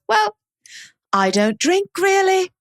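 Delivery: high-pitched mock posh English accent; exaggerated Queen's English accent, pitched high. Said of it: "sound like the Queen".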